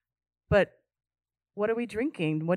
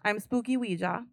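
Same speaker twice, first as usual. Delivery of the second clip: fortune teller; 2 semitones higher, 6 dB quieter than usual. Pretend. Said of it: "and I won't talk like that".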